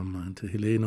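usual speaking voice

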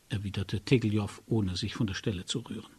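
ordinary delivery, nothing marked

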